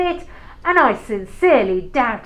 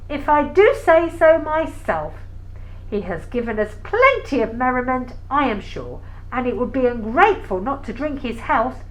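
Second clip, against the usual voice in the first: normal talking voice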